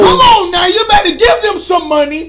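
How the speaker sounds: loud, high-pitched interjection; heckling shout. Real, not acted. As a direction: yell it over the other speaker. Heckles over the co-host's plug.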